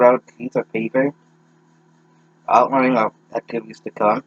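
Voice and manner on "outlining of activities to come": normal talking voice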